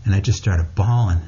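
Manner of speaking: usual speaking voice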